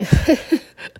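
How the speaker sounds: short unvoiced giggle; a quick tired laugh; breathy giggle. Real, not acted. Sleepy laugh at two a.m.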